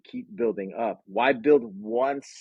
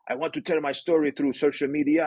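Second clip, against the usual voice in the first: higher-pitched mock French accent; badly faked French accent; horrible French accent; 4 semitones higher than usual. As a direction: imitate a French client with a put-on accent. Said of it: "I'm gonna do a horrible French accent here".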